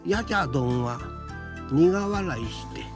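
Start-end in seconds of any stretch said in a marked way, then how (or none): none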